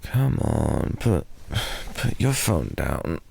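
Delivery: ordinary talk, nothing marked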